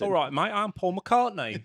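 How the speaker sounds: [name]. Acted. Joking about Americans' limited British accents, mock Liverpool accent. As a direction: put on a drawn-out Liverpool accent. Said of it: "do a [name] accent".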